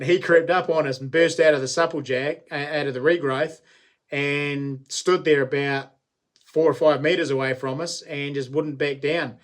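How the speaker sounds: ordinary delivery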